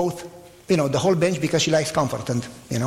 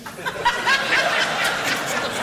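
breathy mocking laugh. Mocking gloomy church members.

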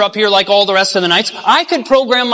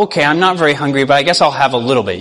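reluctantly polite; 7 semitones lower, faster than usual. Acted. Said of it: "she's trying to be nicer".

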